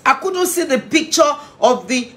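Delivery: ordinary delivery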